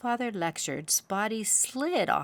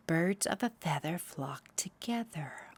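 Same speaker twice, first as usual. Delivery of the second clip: whispered reading; 4 dB quieter than usual. A narrator's reading. Reads sign quietly.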